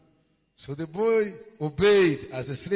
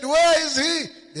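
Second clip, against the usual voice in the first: high, loud, slow royal demand; the king insists, raising his voice; king's loud demand; 11 semitones higher, 9 dB louder, slower than usual. Acted.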